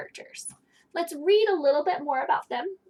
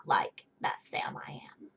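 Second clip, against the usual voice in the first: whispered grumpy line; 13 semitones lower, 8 dB quieter than usual. Acted. Grumpy character's line read.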